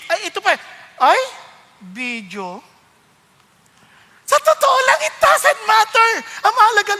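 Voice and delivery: normal talking voice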